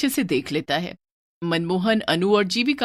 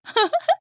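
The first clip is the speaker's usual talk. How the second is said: teasing laugh; 3 dB louder than usual. Acted. Sister mocking scared brother.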